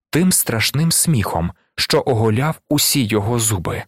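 plain reading voice

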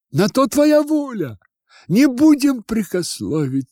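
high-pitched, obliging students; eager agreement. Acted. Students accepting the old woman's terms, high eager compliance.

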